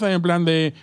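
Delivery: ordinary delivery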